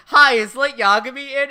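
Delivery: high, loud mock greeting; chirpy visitor. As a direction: imitate an eager caller at the door. Acting Misa at the front door.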